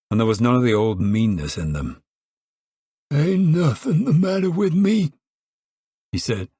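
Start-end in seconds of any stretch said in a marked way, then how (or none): 3.1-5.1 put-on high old man's voice, stubborn; stubborn Grandpa. Grandpa insists to Al and Pa he's fine, high and stubborn. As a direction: crank it up high like a cranky grandpa.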